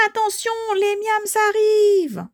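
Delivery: high, stretched warning cry; alarmed warning. Acted. Shouted warning of approaching miams. (cri d'alerte aigu et affolé)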